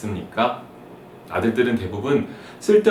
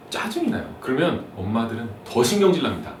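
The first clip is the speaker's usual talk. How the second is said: ordinary talk, nothing marked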